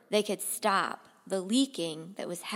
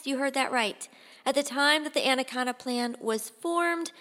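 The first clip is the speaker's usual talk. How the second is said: plain reading voice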